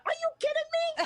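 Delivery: high squeal of disbelief; retold shriek of surprise; shocked disbelief. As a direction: squeal it like you can't believe who's there. Retelling shock on meeting star.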